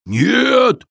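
loud giant bark. A narrator's reading. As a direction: snap it out loud. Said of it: "рявкнул великан".

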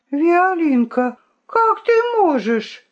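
high, drawn-out appalled plea; hurt reproach. A narrator's reading. The accused rooster reproaches the princess, hurt and shocked. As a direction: stretch the name, soaring in reproach.

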